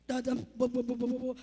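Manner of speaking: low, steady imitated drum; mouth-drum the theme. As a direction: boom the entrance beat. Imitating entrance music.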